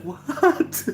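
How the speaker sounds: high laughing disbelief; squeaky laughing what; surprised what. Retold or imitated. Reacting to absurd movie line.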